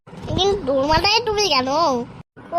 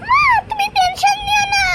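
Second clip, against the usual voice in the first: put-on much higher kid voice; childlike reassurance. Child telling mother not to worry, much higher childlike voice. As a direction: squeak it like a small child.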